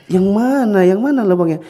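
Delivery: high, loud mock puzzlement; playful father. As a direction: feign confusion. Father plays dumb.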